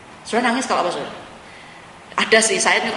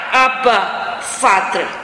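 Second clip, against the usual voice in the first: crying out; 5 dB louder, much slower than usual. Shown as a demonstration. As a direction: call out Abba as a desperate plea. Preacher voicing the cry of prayer.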